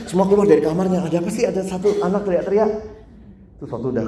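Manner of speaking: normal talking voice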